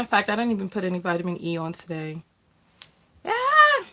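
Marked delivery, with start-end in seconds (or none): 3.2-3.8 surprised yelp. Real, not acted. Sudden cry mid-talk.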